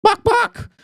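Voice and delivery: high clucking bok bok; chicken clucks. Acted. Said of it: "a robotic voice, which is Saki".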